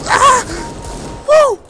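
winning a duel, loud whoop; excited whoop. Real, not acted. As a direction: let out a triumphant cheer. Gets a kill, celebrates.

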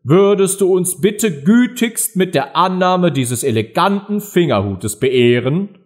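high, pompous presentation speech; solemn ceremony. A narrator's reading. Dodo ceremonially presents Alice with a thimble, solemn. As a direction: present it with grand formality. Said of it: "feierlich den Fingerhut mit den Worten überreichte".

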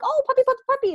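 high puppy-calling voice. Retold or imitated. Owner calling her puppy away from another dog, high sing-song coo. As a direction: coo the puppy's name to win its attention. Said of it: "And I'm like".

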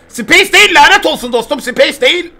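frustrated yell. Real, not acted. Streamer cursing the wrong key to chat, frustrated shouting. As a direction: vent at the controls loudly.

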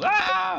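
loud high yelp. Pretend. Streamer doing a repeating gag, loud high yelp. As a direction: break off with a yell.

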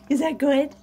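usual speaking voice

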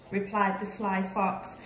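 ordinary delivery, nothing marked

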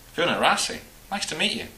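lightly voiced Lowland Scottish lilt; Lowland Scottish brogue. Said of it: "Her accent slipped into a comfortable Lowland brogue".

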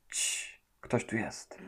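hushed whisper, breath only; quiet warning whisper. Acted. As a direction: whisper so nobody hears. Hushing, someone is nearby.

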